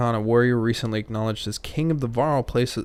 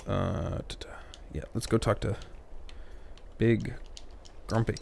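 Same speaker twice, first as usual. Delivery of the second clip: hushed aside under the breath; soft unvoiced whisper; 8 dB quieter than usual. Real, not acted. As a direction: whisper it to yourself. Whispered asides while playing.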